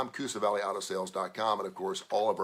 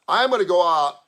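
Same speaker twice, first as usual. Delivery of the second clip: emphatic shout; 9 semitones higher, 12 dB louder than usual. Real, not acted. Bold on-air declaration.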